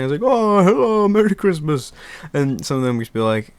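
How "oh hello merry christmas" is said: higher and louder put-on jolly Santa; mock Santa welcoming customers. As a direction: greet shoppers in a hammed-up Santa voice. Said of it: "a voice and everything".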